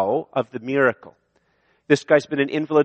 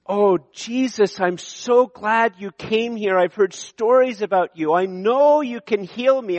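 hopeful grateful sick man; 7 semitones higher than usual. Pretend. The sick man pleading with Jesus, hopeful and high. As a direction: gush with gratitude to Jesus. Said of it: "The man doesn't say".

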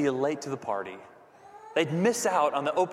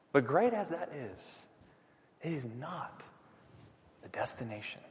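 hushed; 3 semitones lower, 9 dB quieter than usual. Real, not acted. Hushed contrast in sermon.

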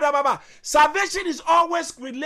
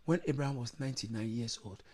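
soft aside; 14 dB quieter, faster than usual. Real, not acted. Quiet scripture reference, far off.

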